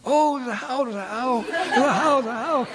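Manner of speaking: high, laughing pretend alarm; mock panicked boys. Cowherd boys fleeing the how, high laughing alarm. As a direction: boys crying out together, half laughing. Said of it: "crying out in unison".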